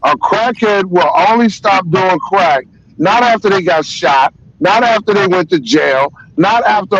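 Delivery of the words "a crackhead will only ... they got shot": ordinary talk, nothing marked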